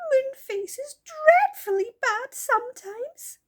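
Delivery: high, loud sobbing little fairy. Acted. Silky sobs to the children about Moonface, high and tearful. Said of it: "said Silky, wiping her eyes".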